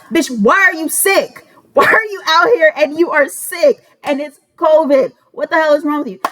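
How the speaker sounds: high, exasperated mock scolding; retold rant. Scolding the roommate for being out while sick, high and exasperated.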